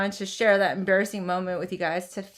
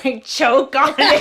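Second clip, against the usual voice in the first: laughing; 4 semitones higher, 8 dB louder than usual. Real, not acted. Laughing while telling choke.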